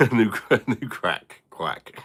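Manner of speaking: laughing through the words; giggling as he corrects himself; words broken by laughter. Real, not acted. Mishearing quack as crack, laughing.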